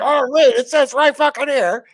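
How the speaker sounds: loud, high mock protest; outraged rules lawyer. Player challenging the game master over a rule, outraged. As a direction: shout it like an indignant rules lawyer. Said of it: "we definitely play rules lawyer".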